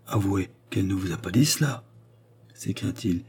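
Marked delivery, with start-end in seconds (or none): none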